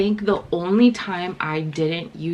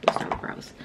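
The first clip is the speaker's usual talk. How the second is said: muttered aside; 7 dB quieter than usual. Real, not acted. Muttered reminder to herself.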